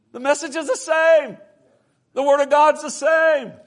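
high, loud declaration; emphatic pulpit shout; full-force preaching. Real, not acted. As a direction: proclaim it to the whole congregation.

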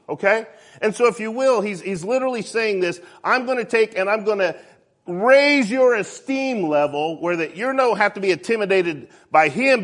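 high, loud, driving sermon voice; full-force preaching. Real, not acted. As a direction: preach it with rising force to the congregation.

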